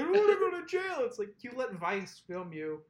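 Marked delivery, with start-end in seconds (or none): 0.0-1.0 high-pitched pretend blubbering; mock crying. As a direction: mimic someone wailing, voice pitched far up.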